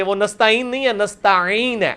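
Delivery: ordinary delivery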